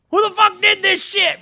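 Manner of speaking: high, slow imitation of an irate father; mock angry dad. Son retells dad discovering it, mock angry outburst. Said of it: "so Dan's like".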